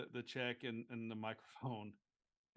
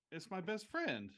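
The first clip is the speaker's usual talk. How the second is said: higher-pitched, acted heartfelt declaration; tearfully happy; 8 semitones higher, 4 dB louder than usual. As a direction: proudly declare it, playing moved. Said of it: "she's of course got tears in her eyes".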